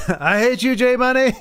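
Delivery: laughing through the words. Real, not acted. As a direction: tease him while laughing. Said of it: "Like, I love it".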